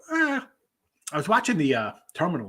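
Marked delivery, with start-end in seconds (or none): 0.1-0.5 a long, high, open yawn; big yawn. Real, not acted. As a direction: let a real yawn out mid-sentence. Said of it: "excuse me, as I yawn".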